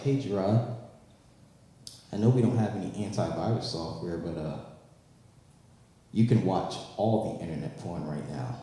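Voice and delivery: low, soft tempting laptop voice; seductive laptop. Acted. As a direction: lure him quietly like a computer.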